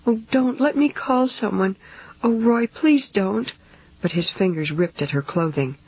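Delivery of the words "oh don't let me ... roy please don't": sobbing. A narrator's reading. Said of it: "she sobbed".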